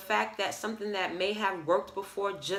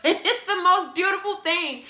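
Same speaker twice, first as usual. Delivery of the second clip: laughing; 10 semitones higher, 7 dB louder than usual. Real, not acted. Laughs about Mother Nature.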